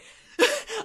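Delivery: a loud high-pitched laugh. Real, not acted. Laughing at her own spiteful book story, high loud laugh.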